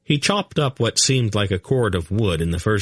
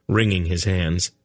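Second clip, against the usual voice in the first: ordinary delivery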